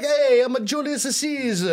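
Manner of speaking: put-on joking Italian-accent Julius Caesar introducing himself; mock Italian accent. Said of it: "shouldn't Romans have an Italian accent".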